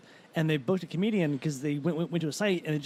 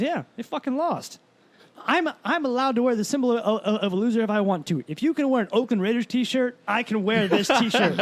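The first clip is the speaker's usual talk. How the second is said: much higher and louder gleeful retort; cocky comeback; 6 semitones higher, 6 dB louder than usual. Retold or imitated. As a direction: win the argument with a grin.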